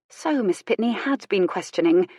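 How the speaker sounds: plain reading voice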